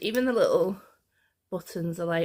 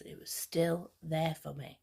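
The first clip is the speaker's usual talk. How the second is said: ordinary talk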